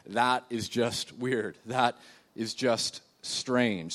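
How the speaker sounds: very slow, drawn-out musing. Retold or imitated. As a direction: savour each word in puzzlement. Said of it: "we could say".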